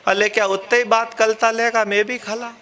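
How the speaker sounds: lisping candidate, r sounds turned to l; childlike lisp, "खला" for खड़ा; stammering lisp. Retold or imitated. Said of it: "पता चला तोतला भी है".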